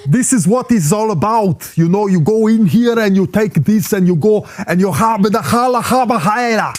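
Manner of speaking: hammed-up mock German accent; bad German accent. Contestant rambling into mock foreign words, bad German accent. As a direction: do German badly, sliding into made-up words. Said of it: "a bit off the German".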